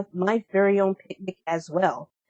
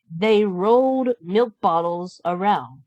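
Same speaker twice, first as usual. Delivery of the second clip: ordinary delivery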